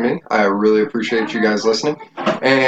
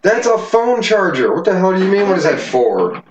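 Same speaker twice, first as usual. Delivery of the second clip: incredulous; 6 semitones higher than usual. Real, not acted. Parent answering what a phone charger is for, incredulous. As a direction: explain the obvious, pitched up in disbelief. Baffled by question about charger.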